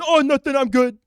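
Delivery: high, loud put-on taunting voice; mocking retort. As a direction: imitate a guy mocking you, loudly. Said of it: "You were mocking me".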